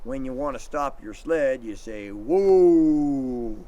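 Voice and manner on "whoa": firm whoa. Shown as a demonstration. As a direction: call it steady and firm to the dogs. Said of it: "Did you hear how I said".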